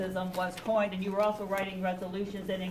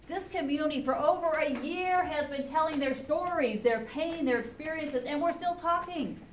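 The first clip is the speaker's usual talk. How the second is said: raised, urgent pleading; impassioned plea; 6 semitones higher than usual. Real, not acted. Chaplain pleading with the congressman, higher and urgent. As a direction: push hard, you're fed up.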